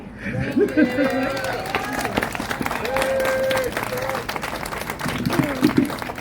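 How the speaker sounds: long breathy laugh of relief; relieved laugh. Real, not acted. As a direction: let the nerves out in a laugh. Nervous speaker finishes her letter.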